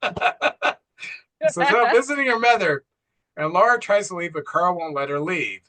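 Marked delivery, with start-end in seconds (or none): 0.0-2.8 high, slow, through laughter; laughing. Real, not acted. Host amused by the husband's dark excuse, laughing. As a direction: let real laughter break into the words.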